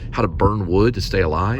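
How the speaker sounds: ordinary talk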